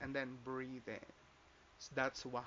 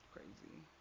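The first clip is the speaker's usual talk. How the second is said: soft disbelief; 2 semitones higher, 14 dB quieter than usual. Retold or imitated. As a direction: murmur "Crazy" to yourself, still voiced. First soft take of crazy.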